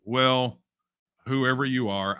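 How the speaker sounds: ordinary delivery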